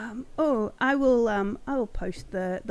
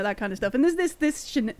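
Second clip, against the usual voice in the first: normal talking voice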